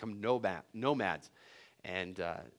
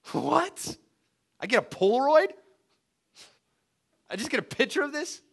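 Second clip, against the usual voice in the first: much higher, louder outraged disbelief; Moses in disbelief; 13 semitones higher, 7 dB louder than usual. Retold or imitated. Moses reacting to God's ban, high incredulous outrage. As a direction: squeal out the shock. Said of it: "Moses reacting".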